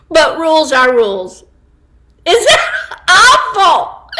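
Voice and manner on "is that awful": real giggle, pitched up and slow; asking through laughter; laughing question. Real, not acted. Reacting to joke, laughing.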